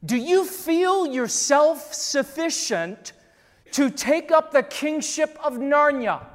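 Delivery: lightly voiced Aslan; Aslan's solemn question. Pastor voicing Aslan to the church, slow and grand. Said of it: "he says this".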